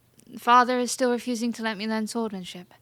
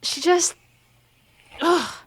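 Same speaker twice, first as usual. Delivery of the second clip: higher and louder, sputtering with anger; fuming frustration; 6 semitones higher, 4 dB louder than usual. Acted. Princess vents to her maid about the instructor, fuming. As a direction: choke on the anger before the words come.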